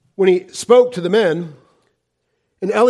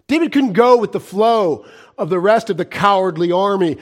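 ordinary talk

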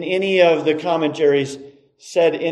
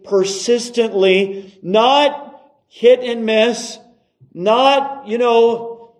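raised, insistent sermon voice; emphatic preaching; 6 semitones higher, 4 dB louder than usual. Acted. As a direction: drive each point home to the congregation.

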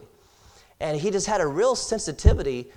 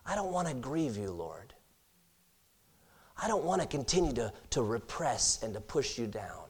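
much softer, a low, intimate plea to the Lord; hushed prayer; 5 semitones lower, 13 dB quieter than usual. Pretend. Believer praying to the Lord not to grieve him, hushed and earnest. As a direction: murmur it like a private prayer.

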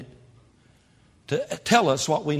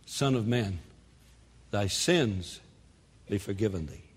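low, soft, spaced-out absolution; gentle pronouncement; 6 semitones lower, 6 dB quieter than usual. Acted. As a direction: lower the voice and grant forgiveness quietly. Voices Jesus forgiving the lame man.

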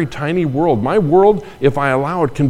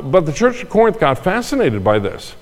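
ordinary talk

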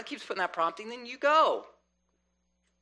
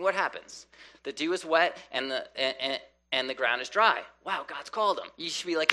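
ordinary talk, nothing marked

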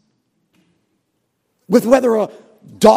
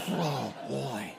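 put-on softer groaning oh boy; mock dread; 7 semitones lower, 13 dB quieter than usual. Bored churchgoer dreading a long service, soft groan.

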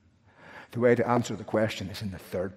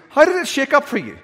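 much higher, louder excited question; eager question; 10 dB louder than usual. Retold or imitated. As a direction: burst out with curious excitement. Retelling what he'd ask the thief.

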